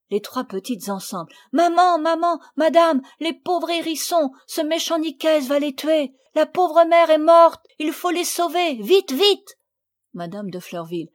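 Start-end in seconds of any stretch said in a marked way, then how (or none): 1.5-9.6 high, loud, rushed panic; breathless and agitated. Acted. The three girls bursting in to their mothers. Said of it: "moins essoufflée".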